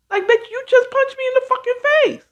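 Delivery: high, fast indignant outburst; outraged that someone punched her. Retold or imitated. Speaker to the puncher, a high fast outraged cry.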